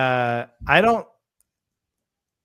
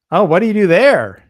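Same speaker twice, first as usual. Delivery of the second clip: hammed-up nosy question, much louder and higher; mock asker; 6 semitones higher, 11 dB louder than usual. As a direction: mimic the clueless asker, loud.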